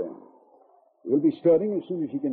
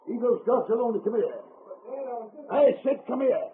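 high, irate command; angry father summoning his boy; bad-tempered order; 7 semitones higher than usual. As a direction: order sharply, pitch raised in anger. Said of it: "In a bad temper".

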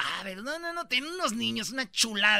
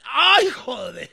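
loud slow shocked shout; 6 semitones higher, 11 dB louder, much slower than usual. Retold or imitated. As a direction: gasp it out, stunned. Doctor shocked at what he sees.

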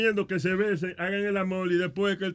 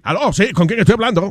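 loud, bright announcer greeting; radio host imitation; 3 semitones higher, 7 dB louder than usual. Host imitating an old radio DJ answering a call, loud.

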